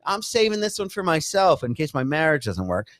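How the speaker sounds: ordinary talk, nothing marked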